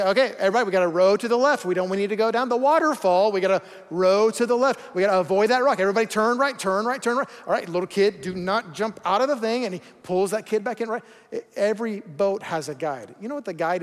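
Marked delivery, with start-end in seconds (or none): 0.0-9.7 louder barked commands; calling out orders. Retold or imitated. Raft guide shouting orders at his crew, loud. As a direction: shout orders over the rapids.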